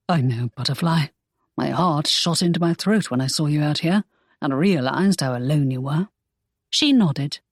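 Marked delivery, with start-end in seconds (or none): 0.1-6.1 lower, tender hero; Draco, tender. Acted. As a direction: comfort her gently and low. Draco comforting Imogen.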